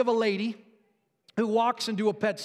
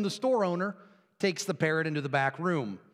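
ordinary talk, nothing marked

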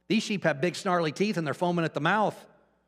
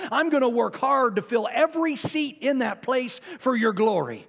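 high, loud preacher's declaration building hard; retold fervent vow; 8 semitones higher, 4 dB louder than usual. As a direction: vow it to God at rising force.

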